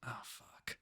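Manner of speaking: breathless unvoiced oh fuck; whispered curse. Caught man whispering his curse to the host. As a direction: whisper it in defeat. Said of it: "just goes".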